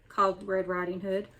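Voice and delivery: usual speaking voice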